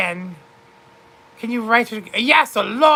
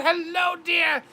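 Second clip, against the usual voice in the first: normal talking voice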